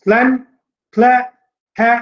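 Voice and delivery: "Slain, clay, है" each punched alone, with pauses between; isolated stressed syllables. Shown as a demonstration. Teacher picking out the stressed syllables, each one punched. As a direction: hit each of "slain, clay, है" separately and strongly. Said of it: "ये तीनों के तीनों जो है stressed हैं".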